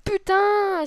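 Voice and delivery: loud furious swear; angry curse. Real, not acted. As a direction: spit it out in rage. Raging at a hard game.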